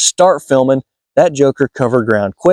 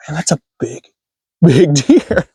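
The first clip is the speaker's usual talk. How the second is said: hushed start bursting high into a laugh; awestruck, breaking into laughter; amazed excitement; 4 semitones higher than usual. Retold or imitated. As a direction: marvel at it, pitch jumping up on the repeat. Retold awe at sighting huge buck.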